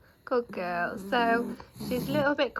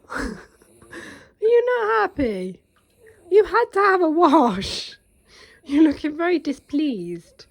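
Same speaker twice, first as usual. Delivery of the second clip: higher, louder, drawn-out mock pity; 5 semitones higher, 10 dB louder than usual. Real, not acted. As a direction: tease the sulky dog with exaggerated sympathy. Teasing the sulking dog.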